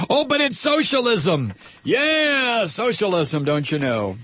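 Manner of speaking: high, sing-song mock of the right; high sarcastic singsong, fading down; sarcastic mockery. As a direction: sneer it in a high mocking voice. Mocking right-wing claim.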